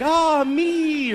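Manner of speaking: airy, high, dreamy sigh; dreamy woman's sigh. Retold or imitated. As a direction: mimic a woman's blissful sigh. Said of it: "They're like".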